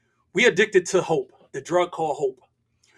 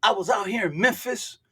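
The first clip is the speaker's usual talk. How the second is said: imitated Memphis street accent, pitched higher; 6 semitones higher than usual. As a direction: do a young man's Memphis accent. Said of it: "I gotta do the accent".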